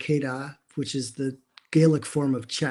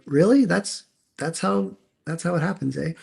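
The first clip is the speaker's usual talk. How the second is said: pitched up in put-on amazement, slowing down; mock surprise; 4 semitones higher than usual. To a friend, mocking the anticlimactic launch, in mock surprise. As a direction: act stunned that it just happened. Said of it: "I'm like".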